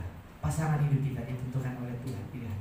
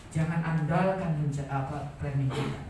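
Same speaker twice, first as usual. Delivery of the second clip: ordinary delivery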